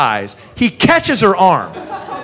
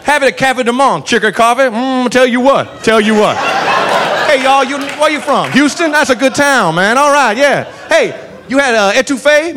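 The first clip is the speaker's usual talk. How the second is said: cheerful cop selling New Orleans food tips; lightly voiced jolly southern cop playing tour guide; 3 semitones higher than usual. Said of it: "proceeds to give us tourist tips".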